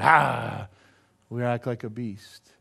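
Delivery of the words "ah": short acted roar of rage; angry roar. Preacher acting out being mad at God, angry roar. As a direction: let out a beastly "ah". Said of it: "when we get mad at God".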